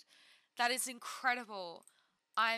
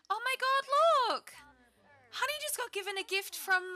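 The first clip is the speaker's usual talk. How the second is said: high, loud, stretched gasp of surprise; sudden excited squeal; surprised; 12 semitones higher, 6 dB louder than usual. Real, not acted. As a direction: shriek it in real delight.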